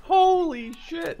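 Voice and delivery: amazed. Real, not acted. Amazed at explosion.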